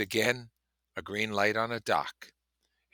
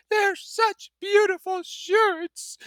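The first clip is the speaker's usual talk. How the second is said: very high, slow, louder wailing sob; sobbing; 7 dB louder, much slower than usual. Acted. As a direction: cry the words out stormily. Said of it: "she sobbed".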